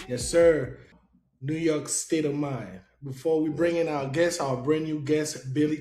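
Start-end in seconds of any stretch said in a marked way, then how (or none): none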